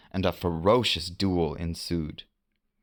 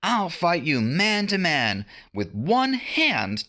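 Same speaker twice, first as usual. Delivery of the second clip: Peter challenging; 10 semitones higher than usual. A narrator's reading.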